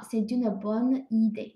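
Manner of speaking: c'est une bonne idée said slowly and clearly; teacher's slow model phrase. Shown as a demonstration. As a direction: repeat it slowly for learners. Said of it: "好".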